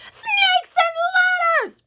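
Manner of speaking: very high, drawn-out scream; panicked yell. A narrator's reading. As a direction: shriek it long and loud. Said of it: "yelled the head of the fire department".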